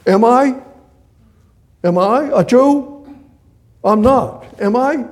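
"am I" high, loud and rising; high, loud challenge on "am I"; tag question. Shown as a demonstration. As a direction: lift "am I" sharply as an incredulous question. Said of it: "it would go like this".

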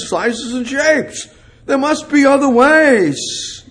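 rising from strong to loud, high preaching; preacher working up to full force; building preaching. Acted. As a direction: build the sermon up to a ringing climax.

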